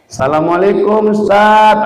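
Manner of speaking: slow, measured greeting; respectful greeting. Retold or imitated. Mother dropping her child at lessons, acted polite greeting. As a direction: greet the teacher smoothly and politely. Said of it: "salamnya mantep".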